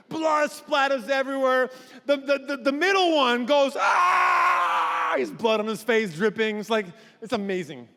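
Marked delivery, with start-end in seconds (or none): 3.8-5.1 high loud yell; battle scream. Acted. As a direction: let out a war scream. Middle kid yells.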